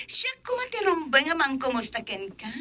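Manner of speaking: giggling through the greeting; laughing. Acted. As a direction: laugh as you say hello. Friends greet happily on the phone.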